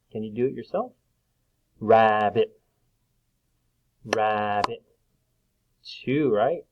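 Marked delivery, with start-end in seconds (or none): 1.8-2.6 "rabbit" loud, in two level beats; split into syllables. Shown as a demonstration. Teacher clapping syllables for children, "rabbit" split in two. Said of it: "Before we clap it". 4.0-4.8 "rabbit" in two level, stretched beats; split into syllables. Shown as a demonstration. Teacher letting children count, "rabbit" split in two. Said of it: "Before we clap it".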